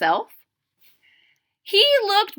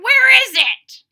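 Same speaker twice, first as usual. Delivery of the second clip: put-on very high, loud old-man holler; 13 semitones higher, 9 dB louder than usual. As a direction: bellow it out.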